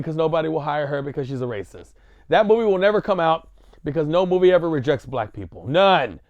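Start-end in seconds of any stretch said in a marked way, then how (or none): none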